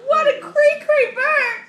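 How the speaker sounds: ordinary talk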